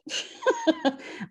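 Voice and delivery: high embarrassed laugh; sudden high giggle; burst of laughter. Real, not acted. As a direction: laugh out at the tech failing. Laughing at failed screen share.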